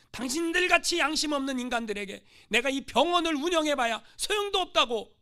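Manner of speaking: loud indignant threat; missionary threatens the natives, loud and angry; threatening. Acted. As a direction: threaten to close the clinic. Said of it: "협박을 했어요".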